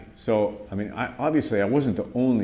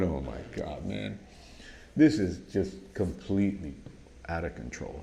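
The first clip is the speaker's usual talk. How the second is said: much lower and softer mutter to oneself; dismayed aside; 4 semitones lower, 6 dB quieter than usual. Retold or imitated. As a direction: quietly sigh it out in disbelief. Judge's dismayed aside to himself.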